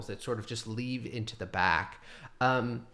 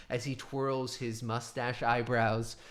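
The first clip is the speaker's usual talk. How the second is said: ordinary delivery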